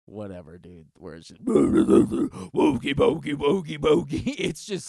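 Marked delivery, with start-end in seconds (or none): 1.4-4.3 loud, drawn-out mock metal singing; cheesy metal vocals. Mocking cheesy metal bands to a friend, loud put-on singing. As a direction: chant nonsense like a cheesy metal frontman. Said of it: "a lot of cheesy shit".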